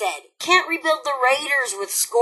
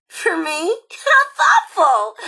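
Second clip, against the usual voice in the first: mock sweet; 5 semitones higher, 6 dB louder, slower than usual. Mocking thanks for gift.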